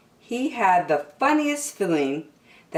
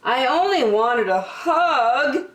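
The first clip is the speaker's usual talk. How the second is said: sniffling; 8 semitones higher, 4 dB louder than usual. Acted. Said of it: "sniffed Douglas".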